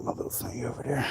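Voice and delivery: soft voiceless whisper to himself. Real, not acted. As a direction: drop to a whisper, almost to yourself. Aside while handling items.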